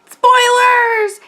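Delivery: high and drawn-out; spoiler announcement. Real, not acted. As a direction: sing out the warning.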